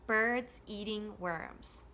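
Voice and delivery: slow beat on BIRDS, EATING, WORMS; three measured stresses, BIRDS, EATING, WORMS; steady stressed rhythm. Shown as a demonstration. Teacher modelling for repetition, slow steady stressed rhythm. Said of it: "the rhythm is going to stay the same".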